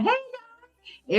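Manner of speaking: cheery greeting. Retold or imitated. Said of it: "So we were just talking".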